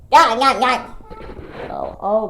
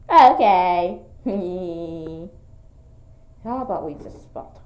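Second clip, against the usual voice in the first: ordinary talk, nothing marked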